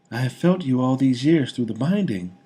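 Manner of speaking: high, loud tender lady's voice; reminding him of their bond; loving young woman. A narrator's reading. Woman reminds the king of their magical bond.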